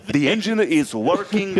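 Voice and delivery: high, slow mock-Italian engineer; horrible Italian accent. Italian engineer reassuring tourists on a ship, bad Italian accent. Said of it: "this accent's horrible".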